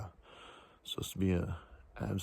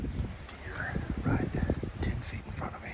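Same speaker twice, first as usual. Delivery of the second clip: whispering; 6 dB quieter than usual. Real, not acted. Quietly spotting nearby deer.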